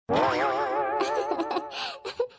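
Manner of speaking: long burst of laughter; hearty laugh. Acted. Friends react to reward joke, laughing heartily. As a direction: laugh out loud in relief.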